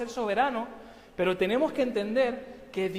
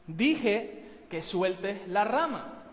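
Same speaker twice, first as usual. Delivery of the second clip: slow and firm; much slower than usual. Acted. Dios repite su orden.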